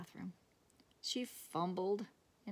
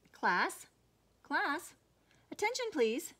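louder, rising call for attention; calling the class; 8 semitones higher, 5 dB louder than usual. A narrator's reading. Principal calling the noisy class to attention. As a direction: call the room to order like a teacher.

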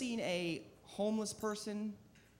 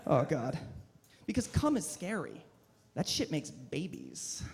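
normal talking voice

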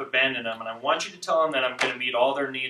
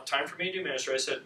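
usual speaking voice

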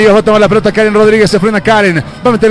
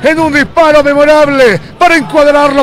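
goal celebration; 7 semitones higher than usual. Real, not acted.

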